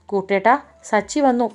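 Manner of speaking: plain reading voice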